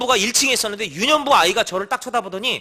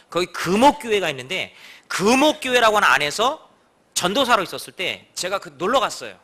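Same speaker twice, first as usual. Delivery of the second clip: usual speaking voice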